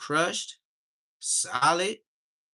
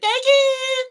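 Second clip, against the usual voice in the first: even higher, louder squealed thank you; very high, loud childish thank you; baby echoing mom; 10 dB louder than usual. Retold or imitated. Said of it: "And I'd be like".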